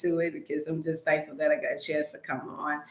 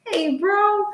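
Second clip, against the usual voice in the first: high, loud "hey, bro"; sudden high-pitched outcry; exclaimed mid-story; 4 dB louder than usual. Acted. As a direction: call it out high and drawn-out. Acts out a cry of dismay.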